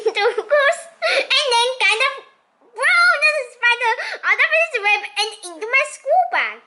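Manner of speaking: can't stop laughing while reading. Real, not acted. Reader losing it over the spider plan, laughing. Reader cracks up mid-sentence.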